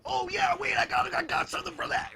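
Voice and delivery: very high, loud burst of enthusiasm; acted eager outburst. Host imagining sharing what they learned, hammed-up excitement. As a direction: jump in, voice shooting way up. Acts out eager offer to share.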